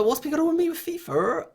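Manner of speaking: mock Bristolian voice, way up high; silly Bristolian accent. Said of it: "went into stupid Bristolian accent".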